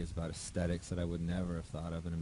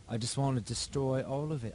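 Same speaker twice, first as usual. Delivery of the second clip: put-on a half-hearted British accent, slightly higher; mock British accent; 5 semitones higher than usual. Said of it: "my terrible British accent".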